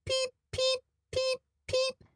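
tiny high squeaky peeps; peeping chicks. Acted. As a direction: peep like baby birds. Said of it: "said four of the pea chicks".

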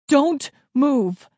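high, loud command; yell. A narrator's reading. Dad yelling back to children under fire, commanding yell. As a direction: shout each word as an order. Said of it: "Dad yelled back".

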